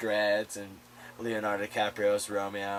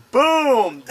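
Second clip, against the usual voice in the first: loud, high explosive shout; dramatic boom; 12 semitones higher, 10 dB louder than usual. Real, not acted.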